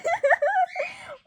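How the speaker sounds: cracking up in delight; real delighted laughter; high real laugh. Real, not acted. Laughing at the twist.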